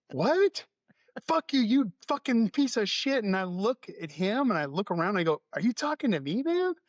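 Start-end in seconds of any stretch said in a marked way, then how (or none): 0.1-0.7 high, loud, rising disbelief; retold yelp of confusion; incredulous what. Retold baffled reply to insult. 1.3-3.2 high-pitched imitated tirade; mock-irate cursing in a raised voice; retold angry abuse. 5.5-6.7 retold puzzled protest, voice raised high; incredulous question. Retold disbelieving question to driver.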